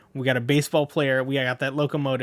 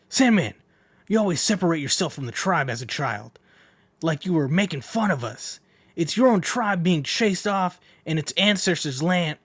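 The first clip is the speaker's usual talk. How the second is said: lightly raised scolding tribesman; a tribesman rebuking Sandman, somewhat higher; different voice; 5 semitones higher than usual. Acted. A tribesman scolds Sandman, slightly raised voice. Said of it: "I'm gonna go with a different voice".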